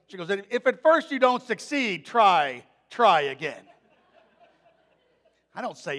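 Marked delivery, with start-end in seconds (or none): 0.3-3.7 daughter's sing-song quote. Retold or imitated.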